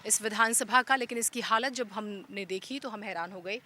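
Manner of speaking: steady reporter's on-the-spot intro; news presenter. Acted. Reporter introducing the village report to viewers, news read. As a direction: present the village's problems to camera like a field reporter.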